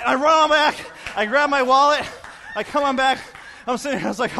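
ordinary talk, nothing marked

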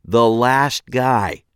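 slow, deliberate repeat; slowly. A narrator's reading. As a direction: spell it out slowly, patiently. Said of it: "I repeated slowly".

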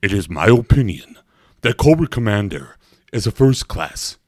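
loud, declaiming cartoon villain; Destro. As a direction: proclaim it with villainous scorn. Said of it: "my favorite was always like imitating".

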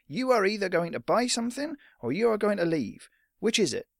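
high, emphatic interruption; exasperated storekeeper; stern shopkeeper. Acted. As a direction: cut the boy off sternly. Shopkeeper gives boy an ultimatum.

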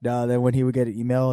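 usual speaking voice

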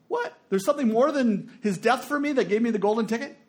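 high-pitched, incredulous questioning; hammed-up disbelief, leaping up in pitch; mock astonishment. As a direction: act amazed at unexpected news. Acts astonished reaction to the verse.

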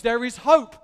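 usual speaking voice

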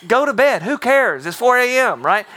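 high, loud, fed-up snap; exasperated retort. Retold or imitated. Leader snapping at the boy on the top bunk to sleep, exasperated. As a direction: shut the kid down at four in the morning. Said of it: "My response".

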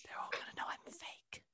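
hushed, creepy inner-critic whisper; barely-there anxious whisper; whispered dread. Acted. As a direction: whisper it like a nagging doubt.

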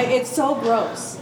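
ordinary talk, nothing marked

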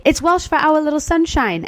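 high-pitched mum imitation; imitating proud mum. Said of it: "And then you would say".

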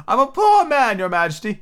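high, pleading kneel-down plea; pleading Hatter. Acted. Hatter on one knee begs the king, high. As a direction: beg the king for mercy.

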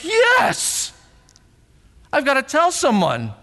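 bursting, high excited yell; can't hold the news in; excited shout. Pretend. Disciples bursting to tell news.

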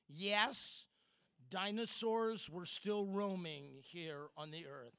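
usual speaking voice

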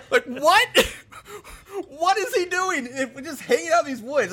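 high laughing disbelief. Real, not acted. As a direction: talk through giggles of disbelief. Cracking up at the scene.

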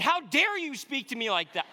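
loud, high, hammed-up indignant father; mock outrage. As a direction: play at scolding an impudent child. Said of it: "my volume's kinda getting up".

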